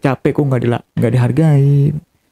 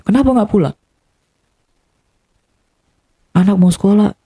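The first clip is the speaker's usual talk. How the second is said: mildly imitated reproach, pitched higher; retold scolding; 5 semitones higher than usual. Wife scolding husband for not coming home, mild retold reproach. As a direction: lightly voice a partner's nagging complaint. Retells partner scolding him for absence.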